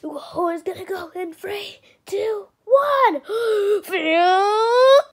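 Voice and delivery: loud rising shout; excited countdown. Acted. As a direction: burst out on the last count. Countdown into time machine.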